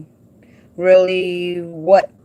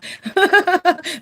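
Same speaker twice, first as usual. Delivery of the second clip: high giggle; 11 semitones higher than usual. Real, not acted. Laughing at the Diva nickname.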